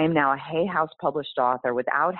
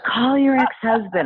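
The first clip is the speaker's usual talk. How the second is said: "Call your ex-husband." repeated higher and louder, pressing; the inner voice insists, raised and firm; insistent inner voice; 7 semitones higher, 6 dB louder than usual. Retold or imitated. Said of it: "this whisper".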